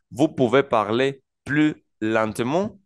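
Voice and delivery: slow, careful French with words set apart; slow model phrase. Shown as a demonstration. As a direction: model the request slowly for beginners. Teacher models a longer French example.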